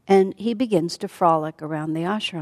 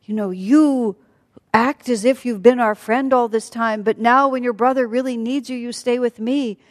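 raised, louder reproach; angry accusation; 5 semitones higher, 5 dB louder than usual. Acted. Sita to Lakshman about his brother, angry accusation. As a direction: accuse hotly with lifted pitch. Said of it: "she becomes angry".

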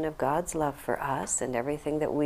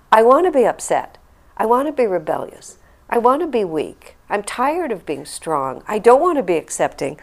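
louder, higher whiny inner complaint; mock protest; 5 semitones higher, 7 dB louder than usual. Voicing her rebellious thoughts in the hospital car, loud and whiny. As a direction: stamp your foot like a tired child.

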